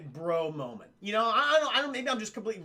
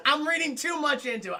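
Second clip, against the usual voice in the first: high, loud, laughing; laughing admission; 8 semitones higher, 5 dB louder than usual. Real, not acted. Streamer owning up to chat about his theory, laughing. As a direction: admit it through real laughter. Laughing at own mistaken reading.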